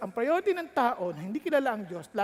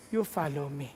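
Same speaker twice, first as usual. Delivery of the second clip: lightly lower, calm firm answer; voice of God; 8 semitones lower, 4 dB quieter than usual. Acted. God calmly answering the anxious young pastor, a little lower. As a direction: settle the worry with quiet authority.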